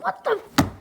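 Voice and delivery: high, exasperated what the; mock outrage at the dogs, pitched high; exasperated outburst. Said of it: "I'm like".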